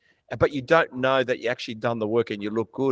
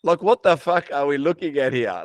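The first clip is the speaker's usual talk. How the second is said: loud, higher, scornful question; exasperated disbelief; 4 semitones higher, 7 dB louder than usual. Retold or imitated. Ranting about gym posers, loud exasperated disbelief. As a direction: blurt it out loudly, appalled. Same thought escalated in scorn.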